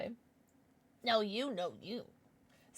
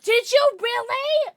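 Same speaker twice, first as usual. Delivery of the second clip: high, loud, slow gasp of a question; surprised; 12 semitones higher, 9 dB louder, slower than usual. Real, not acted. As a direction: pull the words out in surprise. Reacting to chat news.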